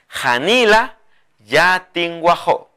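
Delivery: Janila yatinguajó drawn out; slow dictation. Shown as a demonstration. Teacher models the phrase slowly for the viewers. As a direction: say it slowly and clearly. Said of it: "¿Con ojel?".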